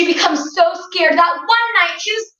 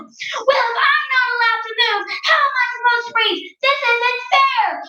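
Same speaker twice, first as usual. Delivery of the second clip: high, fast complaining; whiny wife; 9 semitones higher than usual. Acted. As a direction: protest in a much higher whine. Missus Watson complains back.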